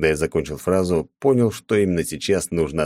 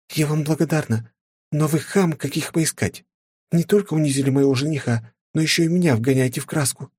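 возмущённая графиня; 10 semitones higher than usual. A narrator's reading. Countess scolds hero.

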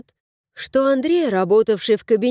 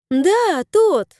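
much higher, brighter exclamation; delighted; 9 semitones higher than usual. A narrator's reading. Tanya delighted, exclaiming to husband much higher. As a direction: glad he remembers. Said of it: "обрадовалась Таня".

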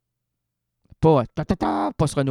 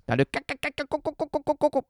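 high, hammed-up stuttering "ca-, co-, co-" clucking; playful stammer of clucks; stuttering cackle; 10 semitones higher, 4 dB quieter, much faster than usual. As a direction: sputter it like a jammed record. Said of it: "dans la cacophonie".

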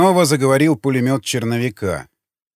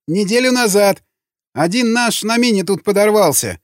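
high, anxious prisoner voice; frightened answer; 7 semitones higher than usual. A narrator's reading. Prisoner confesses to interrogator, frightened. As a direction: give up information in a high, scared voice.

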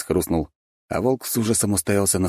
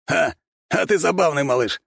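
smirking Bigby; 10 semitones higher than usual. A narrator's reading. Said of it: "усмехнулся Бигби".

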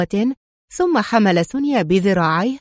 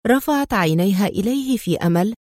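plain reading voice

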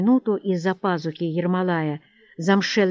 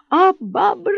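shrieked lament; wailing cry; 12 semitones higher, 4 dB louder than usual. A narrator's reading. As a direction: howl it high, like a victim raising the alarm. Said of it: "завопил купец".

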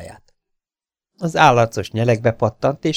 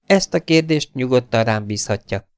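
a touch of impatience, raised pitch; slightly impatient; 5 semitones higher, 3 dB louder than usual. A narrator's reading. Mabel rejects advice on running her estate, slightly impatient. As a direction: brush the advice off, mildly impatient. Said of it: "mondta Mabel kissé türelmetlenül".

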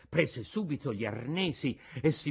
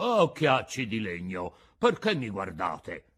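resentful; 5 semitones lower than usual. Acted. Geppetto scolding the wooden eyes, mildly resentful. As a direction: scold the staring eyes with mild offence. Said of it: "disse con accento risentito".

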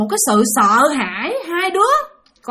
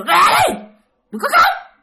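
much higher, louder command; retold shrieked order; 13 semitones higher, 4 dB louder than usual. Woman orders crying children out, mock shriek. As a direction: screech it as the threatening woman. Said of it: "Bà kêu".

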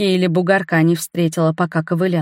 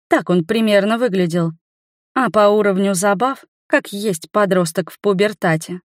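ordinary delivery

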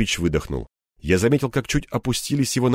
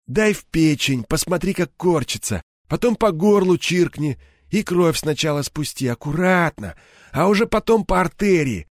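higher-pitched, unhurried coaching; gang boss, coolly instructing; 7 semitones higher than usual. A narrator's reading. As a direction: lay out the killing step by step. Реплики Серого с указаниями. (голос главаря, деловито наставляя)

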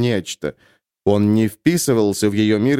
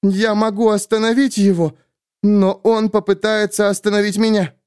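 put-on higher, urgent young heroine; young woman, urgent; 8 semitones higher than usual. A narrator's reading. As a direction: press it, tense and scared. Sulin voiced higher.

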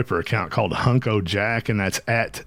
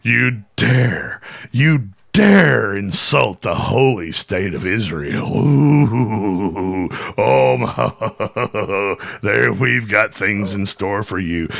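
lower, slow, sneering mock voice; mocking Crenshaw; 4 semitones lower, much slower than usual. Streamer mocks the congressman scolding questioners, sneering. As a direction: play him as a smug villain with a laugh. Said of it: "and you get up there and like".

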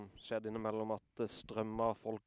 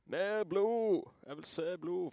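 imitated crowd chant, high and louder; ropende folkemengde; 8 semitones higher, 3 dB louder, much slower than usual. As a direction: call it out high like a schoolyard mob. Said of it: "hundre folk rundt og roper". (etterlignet heiarop)

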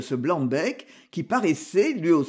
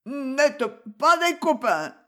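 higher, slow, hiccuped whine; hiccuping drunk; 8 semitones higher, slower than usual. A narrator's reading. Aubry, left behind by the gang, hiccups his drunken reproach. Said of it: "hoqueta Aubry".

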